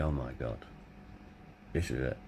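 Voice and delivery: hushed, sinking fear; quiet dread. Retold or imitated. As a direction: breathe it like the worst is coming. Dreading the bad news.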